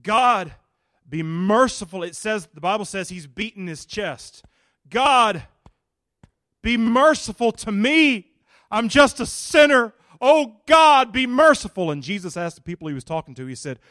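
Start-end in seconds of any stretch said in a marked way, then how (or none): 0.0-11.9 loud, higher desperate plea; pleading sinner's prayer. Acted. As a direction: beg God for mercy, beating your chest. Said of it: "these are the words that comes out of his mouth".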